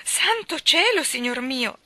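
high, loud, slow startled gasp of words; frightened cry. A narrator's reading. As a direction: cry out in alarm. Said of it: "spaventata da un risultato così differente". (esclamazione spaventata, acuta e forte)

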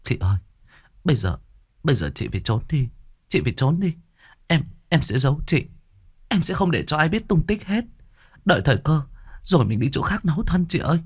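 lightly voiced anxious little sister; urgent younger sister. A narrator's reading. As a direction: rush to hide her sister, pleading. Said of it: "Nó nói".

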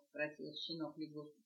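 plain reading voice

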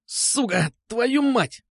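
high-pitched outburst; pained cursing. A narrator's reading. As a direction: curse as if squealing in pain. Captive curses in pain. (визгливо выругаться от боли)

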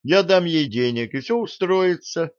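eager, high-pitched reassurance; earnest lying. A narrator's reading. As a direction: lie with carried-away conviction. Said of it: "самозабвенно лгал Гарри".

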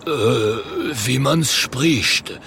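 slow, stretched troll voice; dopey troll. Acted. Potato troll answering Oskar, slow dopey voice. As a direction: drag it out hesitantly. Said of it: "sagt der Troll".

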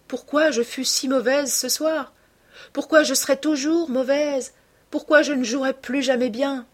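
high, impassioned rhetorical questions; exalted heroine. A narrator's reading. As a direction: lift each question high, with fervour. Heroine's exalted explanation to her lover. (questions exaltées, voix aiguë)

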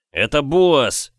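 slow, loud, high yell. Acted. Young man shouts at his drunk brother who the giant is, loud yell. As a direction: drag the name out as a furious warning. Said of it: "заорал я".

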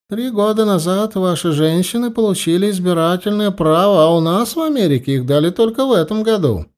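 lively high-pitched American lady; lightly voiced chatty foreign woman.

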